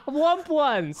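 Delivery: squealing high through laughter; cracking up at the word; laughing. Real, not acted. Mocking Sheila's odd word.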